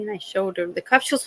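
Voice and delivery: ordinary delivery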